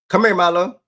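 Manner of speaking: loud high call for the dog. Real, not acted. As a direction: shout for him to come. Yelling for the dog.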